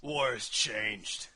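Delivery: drawn-out game quote; quoting the game opener. Retold or imitated. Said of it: "And then you hear".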